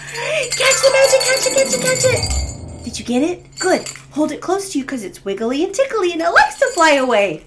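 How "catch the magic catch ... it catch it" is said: excited. Pretend. Pretend catching magic with children.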